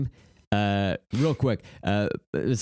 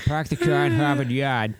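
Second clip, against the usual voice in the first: Boston accent. Shown as a demonstration. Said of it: "Some people like to do".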